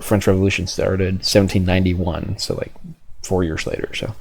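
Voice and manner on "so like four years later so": normal talking voice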